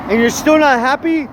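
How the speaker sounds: high, rising incredulous challenge. Acted. Scolding a bitter man who has everything, incredulous. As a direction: lift it up into a disbelieving question. Rant's punchline, first take questioning.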